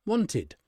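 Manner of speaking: "wanted" in two clear syllables, ending in id; extra -id syllable. Shown as a demonstration. Teacher showing how want becomes wanted, extra syllable sounded. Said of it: "we add an extra syllable".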